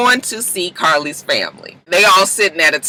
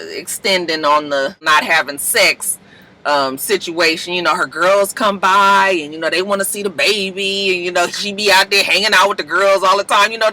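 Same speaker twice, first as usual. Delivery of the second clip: ordinary talk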